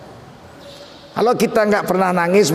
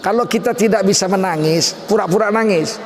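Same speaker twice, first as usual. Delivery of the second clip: ordinary delivery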